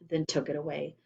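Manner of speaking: ordinary delivery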